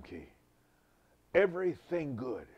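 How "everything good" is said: emphatic preaching. Real, not acted. Preacher stresses wisdom key.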